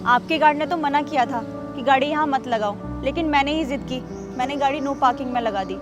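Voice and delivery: quietly owning up to a big mistake; remorseful apology. Acted. Woman softly begs the guard and neighbour for forgiveness, remorseful.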